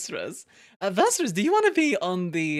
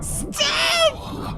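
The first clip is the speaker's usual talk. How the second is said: ordinary delivery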